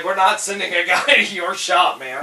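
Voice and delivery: loud, high retold refusal with a laugh; emphatic refusal. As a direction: exclaim it as an amused, outraged refusal. Retells corporate's refusal, laughing.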